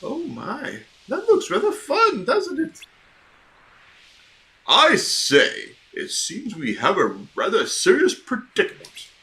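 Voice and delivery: a pompous owl fretting politely. Acted. Owl asking Sora to rescue Piglet, fussy and proper.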